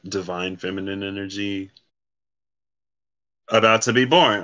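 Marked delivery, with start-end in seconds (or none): none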